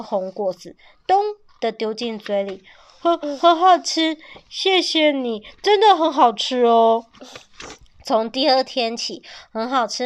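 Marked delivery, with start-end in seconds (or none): 3.0-7.1 flustered thanks. A narrator's reading. Father thanks child for fruit.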